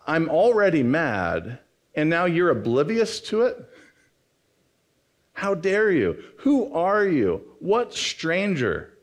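indignant, drawn-out mock outrage; frustrated. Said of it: "you feel so frustrated that you're like".

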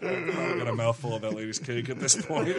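real laughter through words; laughing. Real, not acted. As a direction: laugh while talking. Laughing at himself.

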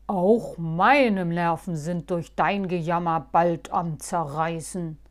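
loud, gruff low retort; a hint of grumpy old gnome. Moosbart retorting to whiny Halbschuh, mildly grumpy. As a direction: grumble back in irritation. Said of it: "erwiderte Moosbart".

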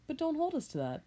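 ordinary talk, nothing marked

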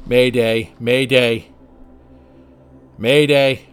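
loud urgent radio call; distress call. Acted. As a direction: shout it into the radio. Pilot calling distress signal.

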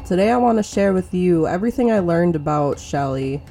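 normal talking voice

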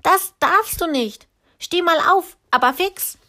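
high, loud bossy telling-off; little girl scolding the giant; scolding child. A narrator's reading. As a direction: order him up right now.

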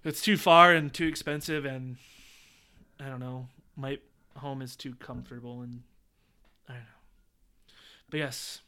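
ordinary talk

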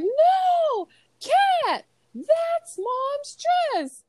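very high, loud, stretched-out cry; hammed-up high-pitched shout; mock horrified yell. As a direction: imitate kids wailing in protest, dragging out the words. Retold children yelling at the cat.

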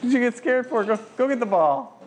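gushing praise for the dog; excited dog praise. Real, not acted. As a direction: cheer the dog on. Coaxing dog.

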